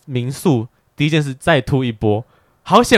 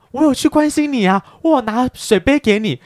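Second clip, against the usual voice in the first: mock defensive whine; 12 semitones higher than usual. Mimicking friends' defensive excuse.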